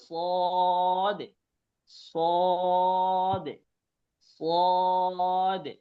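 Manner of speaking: স দে said thick and heavy from the throat; heavy emphatic sad. Shown as a demonstration. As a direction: give the স a full, dark, heavy sound. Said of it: "এটা মোটা করে বলতে হবে".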